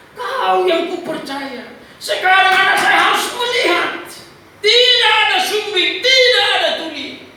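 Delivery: high, drawn-out cry rising to a shout; fervent prayer. Retold or imitated. Father praying outside the delivery room, fervent building prayer. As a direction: declare it to God with growing force.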